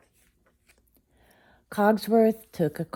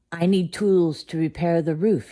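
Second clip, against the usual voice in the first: grumpy; 4 semitones higher, 8 dB louder than usual. A narrator's reading. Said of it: "he said grumpily".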